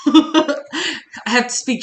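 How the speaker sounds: slow, breathy-free dramatic line with a laugh; hammed-up wistful quote, very slow; wistful mock drama. Streamer mocking herself as a wistful heroine, slow and laughing. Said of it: "she says, stares wistfully out the window".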